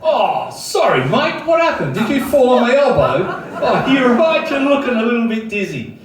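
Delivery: loud, high-pitched fake concern; mock apology. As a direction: feign innocent alarm over the man you just hit.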